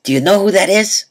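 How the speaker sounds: lightly voiced excited mom; mom's voice. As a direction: mom quizzing her son at the orthodontist. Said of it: "So my mom walks up to me and says".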